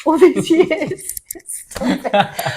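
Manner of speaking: laughing loudly while talking. Real, not acted. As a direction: laugh through the words. Laughs at son's teasing.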